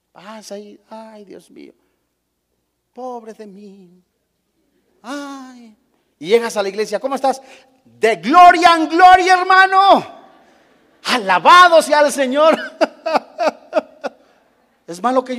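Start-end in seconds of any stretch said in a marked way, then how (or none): 0.1-5.8 imitando un lamento débil y bajito; quéjate suave, como víctima; queja lastimera. Retold or imitated. Fiel quejándose, imitado. 7.0-12.6 saludo alto y fuerte, de gloria en gloria; salúdalo con entusiasmo fingido; saludo exagerado. Retold or imitated. 12.6-14.1 risa alta y breve; risa burlona. Retold or imitated. Risa tras el saludo.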